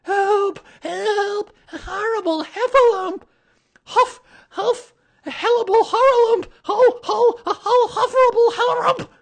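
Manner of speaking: very high, loud panicked shrieking, huffing and muddling the words; frantic tiny animal, words tumbling into gibberish; Piglet crying out. A narrator's reading. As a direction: squeal the alarm while fleeing out of breath. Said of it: "still crying out".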